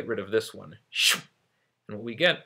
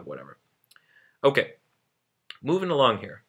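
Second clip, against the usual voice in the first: normal talking voice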